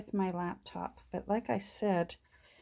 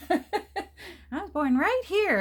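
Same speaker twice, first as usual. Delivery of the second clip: put-on child voice; 11 semitones higher, 5 dB louder than usual. Grandchild quoted, high excited voice.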